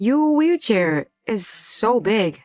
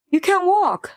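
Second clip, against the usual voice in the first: cruel outburst; 10 semitones higher than usual. Acted. Husband insults wife in quarrel.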